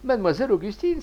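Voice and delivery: elated, high exclamation; enthusiastic cry. A narrator's reading. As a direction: cry her name with mounting delight. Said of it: "s'écria Joseph Lebas dans son enthousiasme".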